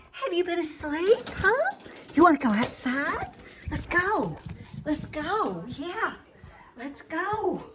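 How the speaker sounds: high, sing-song pet voice; talking to a dog. Real, not acted. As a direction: coo to the dog, high and bright. Greeting her dog Biscuit at home.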